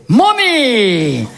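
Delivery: put-on high, drawn-out child's cry; grandson's call. Grandson calling out to his grandmother, high mock child cry. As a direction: call out "mommy" like a little kid. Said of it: "Mon petit-fils a fait".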